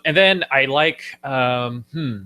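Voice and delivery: ordinary talk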